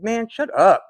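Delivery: loud, high, exasperated "shut up"; telling him to shut up. Retold or imitated. To hyperactive bandmate cousin, a loud exasperated shut up. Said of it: "And I'd tell him".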